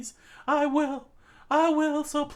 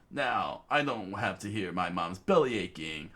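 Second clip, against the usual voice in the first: deep proud classmate. Acted. Rio telling Chikara about the scholarship, deep and proud. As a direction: announce it low and cocky.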